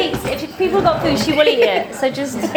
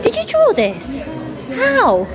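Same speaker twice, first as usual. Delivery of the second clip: wowed praising a drawing. Real, not acted. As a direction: gush over a fan's gift. Fan gives her a drawing.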